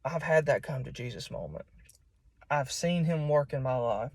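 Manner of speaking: ordinary talk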